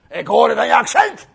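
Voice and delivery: loud soldier's accusation; mock soldier bellow; big soldier. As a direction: call it out as a hulking guard. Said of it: "komt er een grote soldaat".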